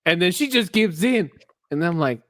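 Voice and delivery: ordinary talk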